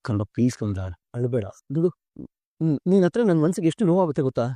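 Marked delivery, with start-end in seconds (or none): 0.0-1.4 tender comfort. Acted. Father comforts crying daughter.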